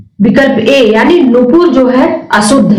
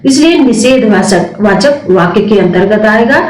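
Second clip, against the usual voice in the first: normal talking voice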